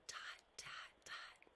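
breathy trailing whisper; suspense whisper. Acted. Narrator whispering the pause before the verdict. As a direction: hold the tension in a hush.

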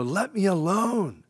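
high, slow anguished plea; angst. Retold or imitated. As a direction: cry out in frustration. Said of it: "there's some angst here".

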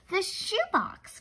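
drawn out, puzzling over "The shoebox"; thoughtful. A narrator's reading. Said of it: "She thought for a moment".

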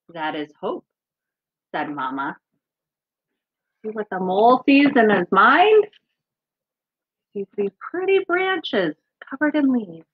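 gentle storybook reading; reading to children. Acted. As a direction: read it to the kids like a picture book. Reads picture book aloud.

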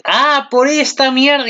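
high, slow cry of realization; sudden realization. Retold or imitated. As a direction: cry out as it finally makes sense. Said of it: "Y tú dices". (exclamación aguda y lenta al entenderlo)

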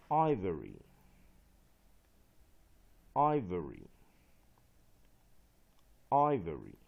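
"Ivory" loud and falling, stressed on the first syllable; word practice model. Shown as a demonstration. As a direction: model "Ivory" firmly, leaving long gaps for repetition. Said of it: "替这两个单字做练习".

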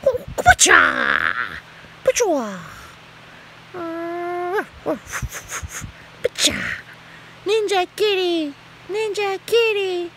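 high, loud, slow mock war cry; hammed-up battle cry. Owner play-battling her cat, hammed-up battle cry. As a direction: shout it like a cartoon fight.

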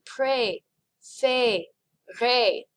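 "Pré, fe, re" broken into syllables with full "ay" sounds; exaggerated accents aigus. Shown as a demonstration. Teacher exaggerates so class won't miss them, "Pré, fe, re" syllable by syllable. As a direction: separate "Pré, fe, re", stretching each vowel. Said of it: "I'm gonna exaggerate those just a little bit more".

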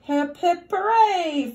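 a bright, loud storybook cheer. Acted. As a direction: cheer the truck home, building to a high hooray. Cheering the happy ending.

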